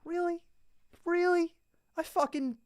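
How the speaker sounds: high, hushed mock disbelief; incredulous. Reliving his annoyance when Ozempic came out, high and incredulous. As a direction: act stunned and betrayed. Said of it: "I was like".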